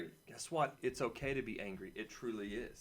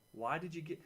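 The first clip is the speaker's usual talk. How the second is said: ordinary talk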